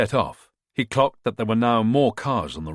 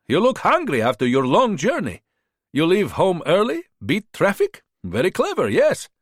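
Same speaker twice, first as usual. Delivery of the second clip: put-on higher-pitched, smooth-talking hustler with a foreign accent; charming border fixer; 9 semitones higher than usual. A narrator's reading. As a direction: sell yourself like a friendly tout. Bogdan's held voice.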